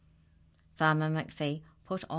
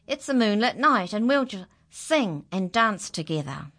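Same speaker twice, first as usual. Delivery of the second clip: loud, higher, eager invitation; excited call; 5 semitones higher, 7 dB louder than usual. Acted. Farmer inviting animals to dance, excited.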